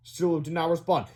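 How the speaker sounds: ordinary delivery, nothing marked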